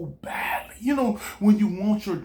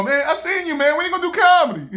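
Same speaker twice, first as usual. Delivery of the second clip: teasing friends; 9 semitones higher, 5 dB louder, faster than usual. Retold or imitated. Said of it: "people go".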